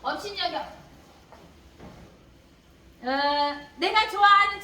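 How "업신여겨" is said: ordinary talk, nothing marked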